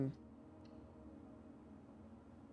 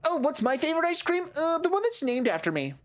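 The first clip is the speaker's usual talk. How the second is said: much higher, smug mock voice; mocking a vain character; 11 semitones higher than usual. Mocking a video game character's vanity, high and smug. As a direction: preen like a self-obsessed cartoon.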